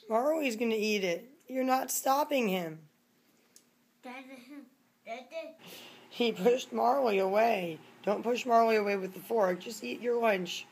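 loud, high scolding. Real, not acted. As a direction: tell him off firmly. Scolding son over cat.

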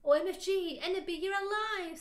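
loud, high shriek of joy; hammed-up excited scream. A narrator's reading. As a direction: cry out in overjoyed disbelief. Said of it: "screamed Vampire".

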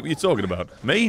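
normal talking voice

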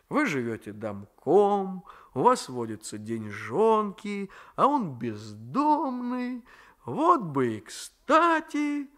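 slow, playful, rising tease; teasing. A narrator's reading. Raisky teasing grandmother about a suitor, playfully. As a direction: draw it out mischievously. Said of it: "продолжал шутить Райский".

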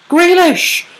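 very high, loud yelp of the name; excited shout. Real, not acted. Calling a near miss on goal, high excited shout.

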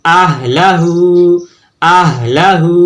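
each আহলাহু ends on a held "হু"; drawn-out final syllable. Shown as a demonstration. Teacher repeats for students, drawing out the end of আহলাহু. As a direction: stretch the last vowel of আহলাহু. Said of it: "টেনে পড়তে হবে".

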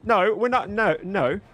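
normal talking voice

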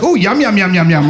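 hammed-up hungry delight, starting high and falling; mock relish. Comic imagines buddy's opposite reaction, mock relish. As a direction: gush as if absurdly delighted. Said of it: "he'd be like".

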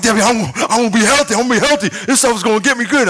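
high, rapid, eager insisting; hyped-up protest to a doubting wife; acted excitement. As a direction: rattle it off like a giddy convert.

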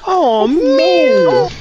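high, loud "oh man"; shocked cry. Real, not acted. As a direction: exclaim in shock. Echoing friend's shocked cry.

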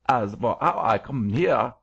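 put-on scolding German-accented angel. A narrator's reading. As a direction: scold like a pompous creature in thick German accent.

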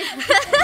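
short, high laugh; real high-pitched chuckle. Real, not acted. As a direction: burst into a giggle. Laughs about going out partying.

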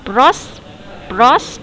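pros- said alone twice, each rising; teacher sounds out the fragment pros-; slow syllable drill on pros-; isolated first syllable. Shown as a demonstration. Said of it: "âm hai".

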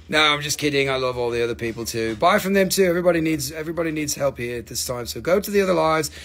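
ordinary talk, nothing marked

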